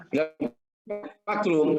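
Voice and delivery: stretched, glitchy connection; choppy breaking audio. Real, not acted. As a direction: voice cutting in and out over a bad call. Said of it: "suaranya putus-putus". (suara putus-putus)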